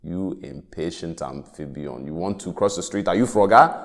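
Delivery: lightly put-on African accent, scolding crosswalk; a hint of an African accent, bossy then threatening. Crosswalk button scolding a pedestrian, in a light African accent. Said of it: "one with an African accent".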